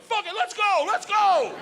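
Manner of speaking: fired-up let's go; pumped up. Retold or imitated. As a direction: psych yourself up to jump. Hyped decision to jump.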